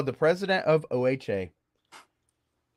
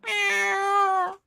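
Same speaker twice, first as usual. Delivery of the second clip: cat meow; much slower than usual. Retold or imitated. Luring little girls with kitten meows. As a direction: lure them with tiny kitten mews. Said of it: "So I'm like".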